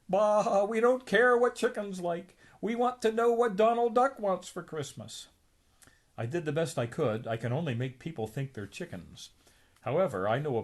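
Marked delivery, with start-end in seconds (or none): 0.1-5.3 high, loud grumpy rant; angry Scrooge. Acted. Scrooge scolding the hypnotist, loud and high. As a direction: bark it out in frustration.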